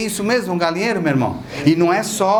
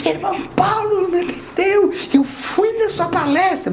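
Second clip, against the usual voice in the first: much higher, pleading whine; whiny complaining believer; 12 semitones higher than usual. Retold or imitated. Believer complaining to Irmão Paulo after his talk, high pleading whine. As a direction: protest like a hurt, needy follower.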